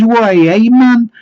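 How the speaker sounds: very high, loud Geordie protest; mock Geordie accent. Fish seller protests at the householder's refusal, mock Geordie. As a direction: exclaim in a hammed-up Geordie accent. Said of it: "I don't think it was a genuine accent".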